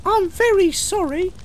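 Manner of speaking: very high little engine apology; sheepish high-pitched engine; abashed. Acted. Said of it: "Thomas was abashed".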